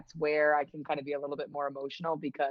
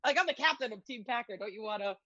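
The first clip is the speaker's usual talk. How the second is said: imitated hotshot captain, pitched up; mock self-important; 4 semitones higher, faster than usual. Teammate bragging to a young fan, mock self-important. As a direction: offer your autograph like a big deal. Retells player bragging to a kid.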